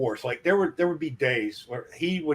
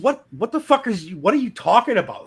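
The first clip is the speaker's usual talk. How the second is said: incredulous shout; 6 semitones higher, 6 dB louder than usual. Retold or imitated. Retold argument with boss.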